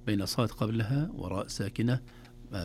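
ordinary talk

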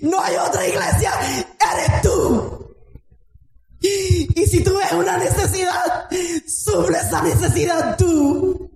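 high, shouting sermon at full force; fiery preaching. Real, not acted. Pastor urging the church to rise, shouting fervently.

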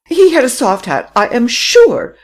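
high, loud, firm woman's voice; insistent lady. A narrator's reading. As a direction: dig in and repeat it. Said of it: "repeated Madame Renaud".

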